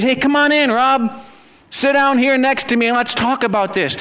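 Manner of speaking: welcoming God voice. Retold or imitated. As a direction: welcome him in like an old friend.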